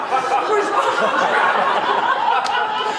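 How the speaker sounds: high, loud laughing fit; long loud burst of laughter. Retold or imitated. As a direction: crack up at the drop. Laughing at the roller coaster drop.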